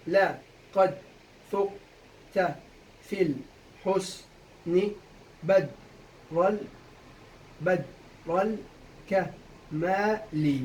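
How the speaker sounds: very slow, drawn-out separate syllables; syllable-by-syllable scansion. Shown as a demonstration. Teacher cutting up the verse for students, slowly, syllable by syllable. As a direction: leave a gap after every syllable. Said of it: "قطعناهم، صح؟".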